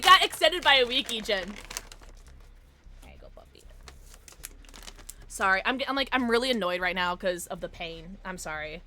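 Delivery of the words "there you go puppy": soft whisper to the puppy; tender whispered praise. Real, not acted. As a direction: hush it gently to the dog. Whispering to her dog.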